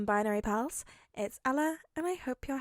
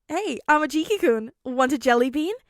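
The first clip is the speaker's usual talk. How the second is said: put-on cheerful teen voice; 4 semitones higher, 8 dB louder than usual. Mirio teasing friend.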